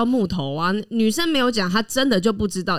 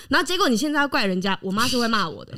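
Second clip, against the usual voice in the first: ordinary talk, nothing marked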